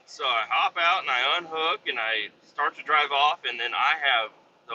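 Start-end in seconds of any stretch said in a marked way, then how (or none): none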